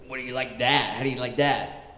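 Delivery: imitated New York boss showing off; lightly put-on New York accent. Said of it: "he talked like he had a New York accent stuck in his throat".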